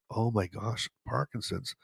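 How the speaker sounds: hushed, imitated realization; very soft, as a light bulb goes off; dawning realization. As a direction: voice her silent realization, barely aloud. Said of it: "saw the light bulb go off".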